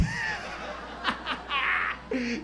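high, prolonged acted cackle; mischievous cackle. Brother laughing after heckling a shopper, mischievous cackle. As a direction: cackle at your own prank.

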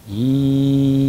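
long steady stretched ee; saying ee. Shown as a demonstration. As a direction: spread the lips and hold ee. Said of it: "이라는 발음을 하게 되면".

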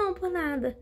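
pitched high, a curt brush-off; dismissive tone. Retold or imitated. Stranger brushing off the video plan, dismissive high tone. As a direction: drop it off like it's nothing, loaded with doubt. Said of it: "por causa dessa entonação".

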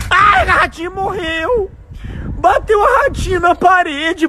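high, slow wailing shout; dismayed shout. Real, not acted. Dad wailing that the kite hit the wall, slow and high. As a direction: wail it like a small disaster.

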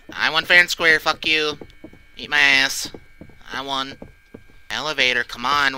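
ordinary talk, nothing marked

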